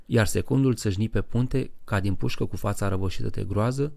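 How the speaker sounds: plain reading voice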